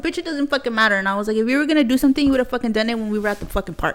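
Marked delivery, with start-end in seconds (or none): 0.0-1.0 higher-pitched, indignant comeback; heated retort. Retold or imitated. Retold confrontation with the woman. 1.4-4.0 raised, higher voice in argument; heated challenge. Retold or imitated. Retold confrontation with the woman.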